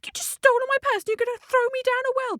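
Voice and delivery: very high, indignant cockerel voice; protesting cockerel. Acted. Cockerel to the driver about to dump him, indignant protest. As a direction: squeal the protest, pitched right up. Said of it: "And the cockerel protested".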